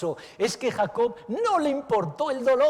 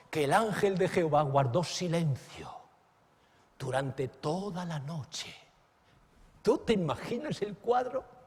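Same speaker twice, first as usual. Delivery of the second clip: usual speaking voice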